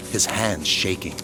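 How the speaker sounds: ordinary talk, nothing marked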